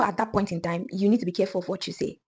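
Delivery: ordinary delivery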